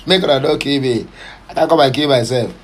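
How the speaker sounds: normal talking voice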